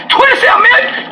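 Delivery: high, stunned shouted question; a family's stunned outcry; shocked. Retold or imitated. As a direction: gasp it like disbelief. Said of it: "the family say, "What?".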